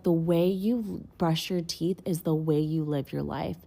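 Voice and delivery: slow, weighty retelling of a friend's saying; slow wise quote. Retold or imitated. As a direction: lay each word down slowly like a lesson. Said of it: "And he was like".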